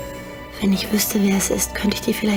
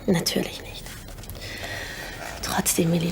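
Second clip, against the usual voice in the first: whispering; 3 semitones lower, 7 dB quieter than usual. Acted. Secret talk, whispered.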